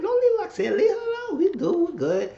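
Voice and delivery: put-on squeaky girly voice to the friend; squeaky high voice. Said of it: "She said".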